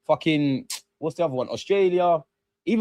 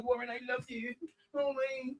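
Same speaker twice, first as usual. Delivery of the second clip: mock swoon; 10 semitones higher, 14 dB quieter than usual. Mocking lovestruck fan.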